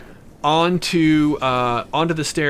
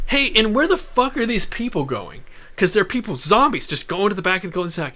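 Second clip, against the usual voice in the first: higher, faster agitated questioning; asking about the zombie crowd, higher and agitated; 7 semitones higher than usual. Retold or imitated. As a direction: rattle it off, spooked.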